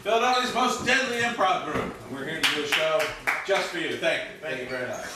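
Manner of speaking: high, punchy welcome to the crowd; show-opening announcer. Acted. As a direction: open the show with big projection, easing off at the thanks. Opens the improv show.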